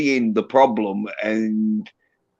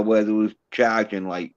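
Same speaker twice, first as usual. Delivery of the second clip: ordinary talk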